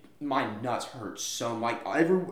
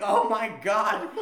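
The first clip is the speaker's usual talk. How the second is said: laughing disbelief; 7 semitones higher, 5 dB louder, slower than usual. Retold or imitated.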